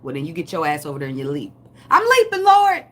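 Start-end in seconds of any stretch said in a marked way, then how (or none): 1.9-2.8 very high, loud holler; exuberant mock shout. Eager believer answering the Lord's call to leap, mock shout. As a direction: holler it sky-high, hammed up. Acts out leaping at God's call.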